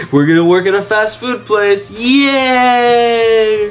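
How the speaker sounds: high, sarcastic celebration; mock cheer. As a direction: fake excitement building to a long yay. Mock cheer at dull adventure.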